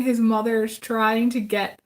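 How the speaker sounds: usual speaking voice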